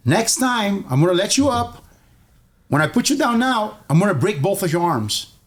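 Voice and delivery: high-pitched mock warning; retold threat. Cousin to the beaten bully, threatening. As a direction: imitate a victor laying down terms, pitch up.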